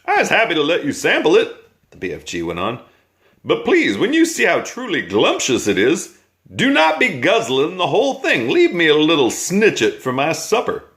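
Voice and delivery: high, bouncy voice of a kindly giant; friendly giant. A narrator's reading. BFG tempting the Bloodbottler.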